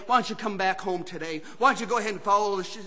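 ordinary talk

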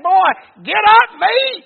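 high, loud pulpit holler; shouted command. Retold or imitated. Preacher voices the order to sleeping Elijah, shouted command.

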